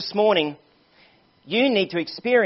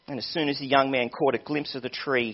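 ordinary talk, nothing marked

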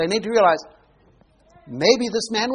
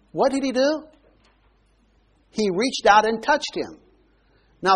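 usual speaking voice

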